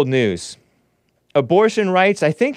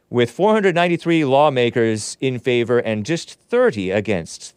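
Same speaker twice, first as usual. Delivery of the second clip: ordinary delivery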